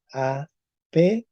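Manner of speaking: "apejla" con una jota fricativa antes de la ele; c vuelta jota. Shown as a demonstration. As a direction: separa "apejla" y raspa la jota. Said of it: "ahora con P once". ("apejla" despacio, con la c hecha jota)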